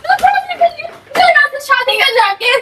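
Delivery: yelling while sobbing; crying shout. Real, not acted. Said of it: "real में रोने लगी भाई". (रोते हुए चिल्लाना)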